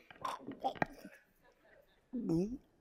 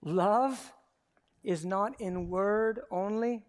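ordinary delivery

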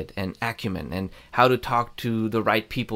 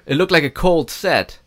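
repeated louder; 5 semitones higher, 5 dB louder than usual. Real, not acted.

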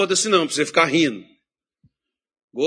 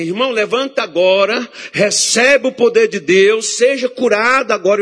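higher, louder, commanding a sick brother to rise; preaching healing command; 8 semitones higher, 5 dB louder than usual. Retold or imitated. Preacher ordering a sick church brother to stand, commanding. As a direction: call the healing down on him. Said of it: "Aí eu vou falar assim".

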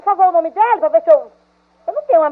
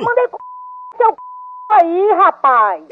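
drawn-out, indignant retort; angry; much slower than usual. Real, not acted. Woman stretches the words out in anger at the prankster. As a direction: stretch it out in outrage.

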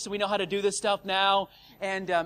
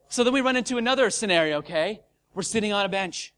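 ordinary talk, nothing marked

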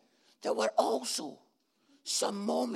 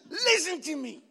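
angry wife; 5 semitones higher than usual. Retold or imitated. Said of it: "angry that the vein in their neck".

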